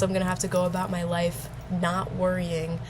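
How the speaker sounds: ordinary talk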